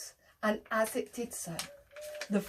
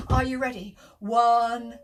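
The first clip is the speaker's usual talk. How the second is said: ordinary delivery, nothing marked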